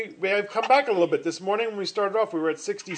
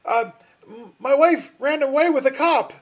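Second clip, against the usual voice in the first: nervous driver; 8 semitones higher, 6 dB louder than usual. Acted. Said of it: "And the guy said".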